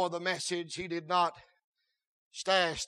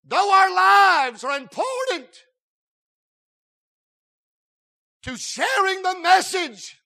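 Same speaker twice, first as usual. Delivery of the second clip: loud, sung-out preacher cadence; hooting revival preaching; full-force preaching; 9 semitones higher, 12 dB louder, slower than usual. Acted. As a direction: preach it out to the whole church. Preaching against hogwash saying.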